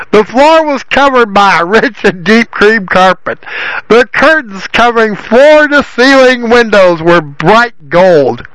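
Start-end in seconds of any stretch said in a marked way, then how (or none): none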